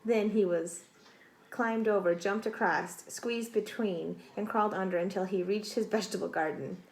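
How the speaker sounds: usual speaking voice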